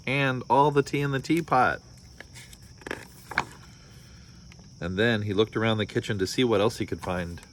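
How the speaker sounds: storybook read-aloud with voiced characters; story narration. A narrator's reading. Parent reading a picture book to listeners, lively storytelling. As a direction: read it like bedtime story time.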